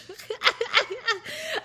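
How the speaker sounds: high-pitched giggling burst; helpless high laugh; high laughter. Real, not acted. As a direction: crack up at your own story.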